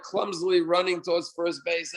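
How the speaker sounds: ordinary talk, nothing marked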